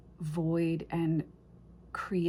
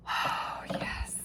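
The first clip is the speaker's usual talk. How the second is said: whispered yes. Real, not acted. Whispered delight at the deck.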